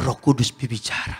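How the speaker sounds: very low, hushed pronouncement; mock-solemn answer. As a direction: feign holy insight in a deep, soft voice. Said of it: "Tahu dari mana kamu?". (pura-pura khidmat dengan suara rendah)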